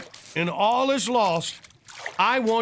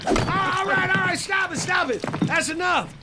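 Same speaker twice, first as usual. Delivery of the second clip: pleading shout; 8 semitones higher than usual. Acted. Begging friend to stop hitting.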